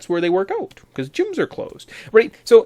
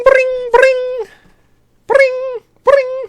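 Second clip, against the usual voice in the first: high, loud trilled ringing noise; shrill spoken ring-ring; imitated phone ring; 8 dB louder, much slower than usual. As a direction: mimic an old telephone ringing, piercingly high.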